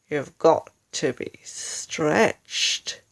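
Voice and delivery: slow, lightly voiced sly husband; slow sinister husband. Husband gives wife his cruel cure, slow and sly. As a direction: drawl it out with menace.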